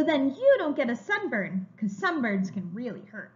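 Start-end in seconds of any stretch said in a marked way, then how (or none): none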